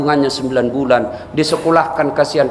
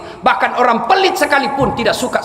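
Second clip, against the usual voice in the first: higher, fervent sermon voice, each question driven home; preaching at full force; 10 semitones higher than usual. Acted. Preacher urging the congregation to give alms, fervent preaching. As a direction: rouse the crowd to give.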